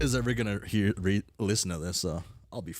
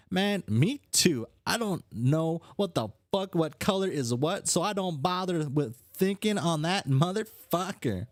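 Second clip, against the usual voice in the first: higher-pitched, cursing troll voice; brash troll character; 10 semitones higher, slower than usual. Acted. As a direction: play a mouthy, irreverent character.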